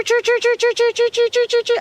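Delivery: high repeated choo chirps; high bird alarm imitation; choo alarm call. Shown as a demonstration. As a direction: call out the warning like a small bird. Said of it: "if you start hearing that choo".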